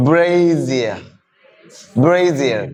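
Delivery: "Brazier" as BRAY-zee-uh, buzzed z; correct pronunciation. Shown as a demonstration. Teacher repeats for the class, correct form clearly modelled. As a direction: model "Brazier" carefully, falling on the last syllable. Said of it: "Not a brazier".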